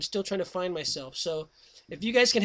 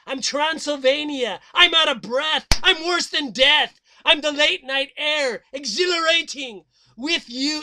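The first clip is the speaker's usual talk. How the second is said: higher, louder climax; dramatic build; 7 semitones higher, 10 dB louder than usual. Acted. Poem reaching its climax, rising thrill.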